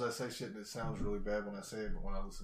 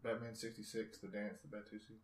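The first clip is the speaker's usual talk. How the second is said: mouth full; 12 dB quieter than usual. Real, not acted. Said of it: "Can you hear me chewing?".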